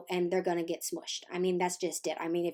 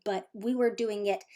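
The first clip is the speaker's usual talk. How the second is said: ordinary delivery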